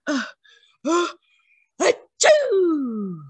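building sneeze, ending in a high achoo; the bear, tickled by whiskers, sneezes hugely; big sneeze. Acted. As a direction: wind up and let a huge sneeze out. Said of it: "gave an enormous sneeze".

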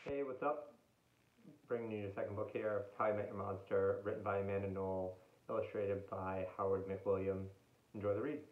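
quick quiet chatty intro; laid-back book introduction; casual intro. Real, not acted. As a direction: toss it off to viewers.

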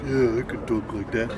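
lightly put-on audition voice; copying put-on voice. Actor proves he can talk like the director, copied voice. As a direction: imitate an actor copying the voice just shown. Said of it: "Can you talk like this".

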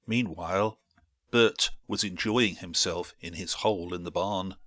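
usual speaking voice

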